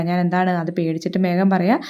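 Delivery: ordinary talk, nothing marked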